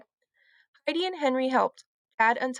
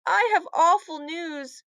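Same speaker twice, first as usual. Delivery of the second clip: slow, higher, heavy tone; gloomy news; 6 semitones higher, 3 dB louder than usual. A narrator's reading. Heidi telling Lucy bad news, slow and gloomy. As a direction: break the bad news gravely. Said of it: "said Heidi".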